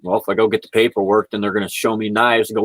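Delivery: usual speaking voice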